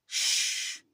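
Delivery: loud, long shush; imitated sharp hush to silence someone. Six-year-old shushing the new carer, loud hush.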